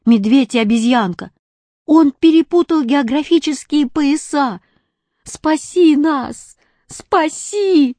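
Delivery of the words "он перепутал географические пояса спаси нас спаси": pleading cry. A narrator's reading. Animals begging from mountain.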